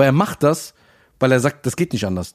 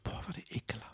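whispered in disgust; a real whispered "boah, war der ekelhaft"; 2 semitones lower, 22 dB quieter, faster than usual. Real, not acted. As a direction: breathe it out, grossed out. Disgusted about the restaurant.

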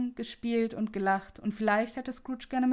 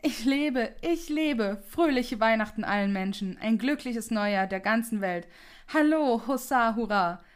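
joyful calling out; 4 dB louder than usual. Acted. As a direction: call out to the whole world, overjoyed. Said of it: "rief in die Welt hinaus".

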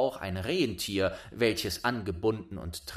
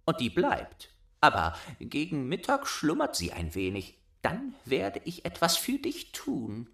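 put-on bossy little robber girl; 7 semitones higher than usual. A narrator's reading. Robber girl claiming Gerda, high bossy child voice. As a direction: demand it like a spoiled robber girl.

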